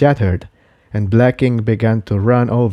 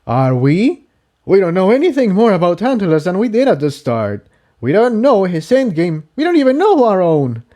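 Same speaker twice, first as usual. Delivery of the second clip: higher, quick and bitter; higher colt voice, bitter; Jigsaw, bitter; 9 semitones higher than usual. Acted. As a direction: challenge her doubtfully. Jigsaw glares angrily.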